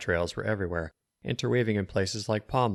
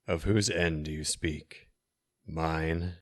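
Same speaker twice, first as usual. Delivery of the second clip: menacing wizard; 3 semitones lower, much slower than usual. A narrator's reading. Wizard questions the prophet.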